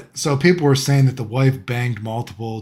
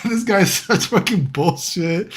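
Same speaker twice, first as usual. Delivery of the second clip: laughing; 6 semitones higher, slower than usual. Real, not acted. Laughing at the guy's story.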